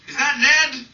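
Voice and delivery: loud, high call from another room; mother yelling. Retold or imitated. Mother calling out to the phone from afar. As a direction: shout it from the background. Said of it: "my mother yelled from the background".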